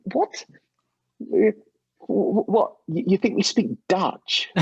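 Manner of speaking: high, stammering mock astonishment; incredulous disbelief. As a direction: replay your own baffled inner reaction. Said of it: "I thought".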